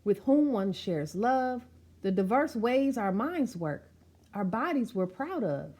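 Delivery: rhythmic, sing-song read of a picture-book poem; spoken-word storybook delivery; rhyming verse reading. Acted. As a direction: recite it with a steady bouncing beat.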